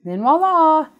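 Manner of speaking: high, loud voila; excited reveal. Real, not acted. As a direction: announce it like a magic trick. Big reveal of painted piece.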